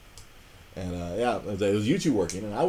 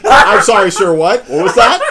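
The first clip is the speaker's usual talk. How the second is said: imitating a gloating clerk, loud and high; gleeful mock deafness; 8 semitones higher, 16 dB louder than usual. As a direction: shout it as if you didn't hear, relishing it. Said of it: "The glee that this man had".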